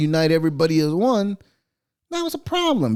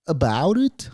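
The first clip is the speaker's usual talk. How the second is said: Canadian-sounding about it; Canadian accent. Real, not acted. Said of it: "I sounded like Canadian there".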